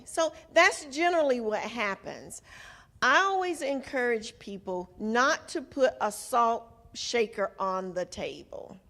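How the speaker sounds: usual speaking voice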